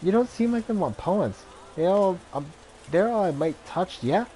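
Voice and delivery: a gamer woman, lilting Irish, interrupted at play; lyrical Irish accent. Acted. Gamer woman brushes off a stranger, lilting Irish accent. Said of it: "lyrical accent".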